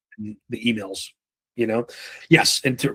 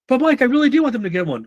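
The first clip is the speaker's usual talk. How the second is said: objecting trainee; 11 semitones higher than usual. Retold or imitated. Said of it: "imagined trainee objecting".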